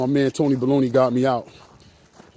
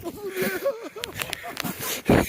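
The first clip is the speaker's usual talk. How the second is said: very high, soft giggle; squeaky quiet laughing fit; 6 dB quieter than usual. Real, not acted. Laughing about being in water.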